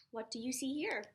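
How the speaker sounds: ordinary delivery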